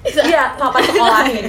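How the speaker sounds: loud, slow words through laughter; real laughing. Real, not acted. Friend correcting her muddled words to the others, laughing hard.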